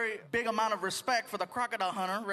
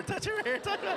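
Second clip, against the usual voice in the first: higher, pleading, half laughing; high breathless plea; 9 semitones higher than usual. Retold or imitated. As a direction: beg to be touched, shaken. Hysterical after near miss.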